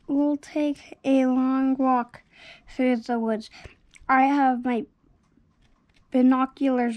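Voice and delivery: ordinary delivery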